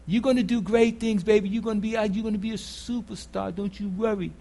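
soft, hushed, loving reassurance; tender God. Retold or imitated. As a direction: coax a child to believe in herself. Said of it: "What do you think God says to you".

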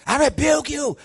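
high, loud mock-furious yell; hammed-up shout at the devil; shouted rebuke. Preacher mimicking someone yelling at the devil, shouted rebuke. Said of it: "You see, that's the difference".

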